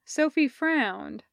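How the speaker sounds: ordinary delivery, nothing marked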